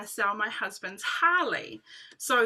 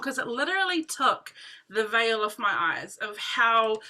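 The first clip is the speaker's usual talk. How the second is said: ordinary talk, nothing marked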